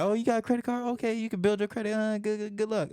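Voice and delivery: high-pitched mock encouragement; high, quick, offhand send-off; imitated casual well-wishers. Imitates others brushing off his card.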